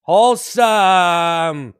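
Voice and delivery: "Wholesome" stretched very slow and loud; drawn-out word. Acted. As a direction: drag out "Wholesome" like a slogan.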